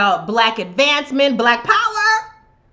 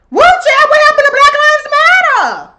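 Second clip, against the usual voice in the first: high, loud shocked squeal; high loud outcry of disbelief; 7 dB louder than usual. Real, not acted. Shocked reaction to the headline.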